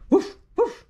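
bright loud woof; dog bark. Acted. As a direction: bark it out like a friendly puppy. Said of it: "said Dog".

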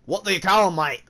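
Aussie voice put on for a question; Australian accent. Gamer asks the Australian stranger, mock Australian accent.

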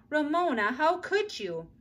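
a touch of scolding; mother scolding her daughter; reproach. A narrator's reading.

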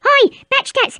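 usual speaking voice

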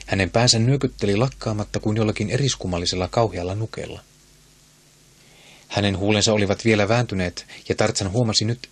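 usual speaking voice

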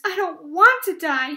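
high, loud tearful moan; distressed, complaining cry. A narrator's reading. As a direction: wail it miserably, lightly acted. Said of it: "he moaned".

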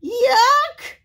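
very high loud squeal; squealing yuck. Acted. Pigs squeal in disgust at the snotty sneeze. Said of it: "squealed the little pigs".

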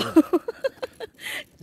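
bright burst of laughter; laughing at her own joke; malakas na tawa. Real, not acted. (natatawang halakhak)

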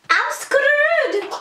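high squeal; mock-panicked cry. Real, not acted. No charger and camera dying, comic high squeal.

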